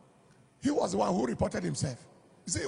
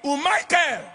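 higher-pitched calling-out of a name; mother's call; 7 semitones higher, 4 dB louder than usual. Retold or imitated. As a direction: call out like a mother summoning her child. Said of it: "There is even a way he will call you".

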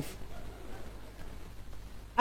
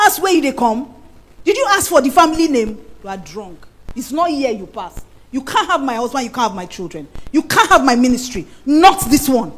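fierce rebuke; 5 dB louder than usual. Acted. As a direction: drive Satan off like a lioness.

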